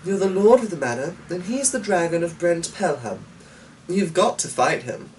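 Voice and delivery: high, quick, lightly voiced urging; urging daughter. A narrator's reading. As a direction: push him toward the fight. Eleanor urging father.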